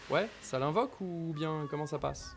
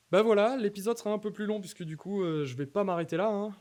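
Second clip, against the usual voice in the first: excited announcement; 7 semitones higher, 5 dB louder than usual. Real, not acted. Tells viewers episode runs longer.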